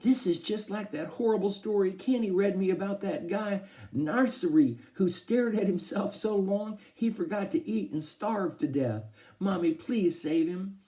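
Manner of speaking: put-on high, distressed child voice; blurting little sister. A narrator's reading. Joey begs Mommy to save Byron, high childlike blurting. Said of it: "Joey blurted out".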